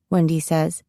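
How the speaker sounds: plain reading voice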